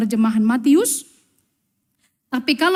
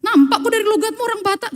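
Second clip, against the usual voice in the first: teasing accusation; 10 semitones higher than usual. Retold or imitated.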